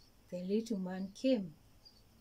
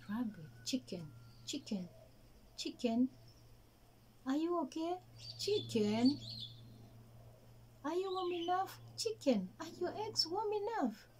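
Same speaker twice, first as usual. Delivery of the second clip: higher, anxious little rabbit voice; fussing rabbit; 8 semitones higher than usual. Acted. Rabbit fussing over the nesting chicken. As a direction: check on her over and over.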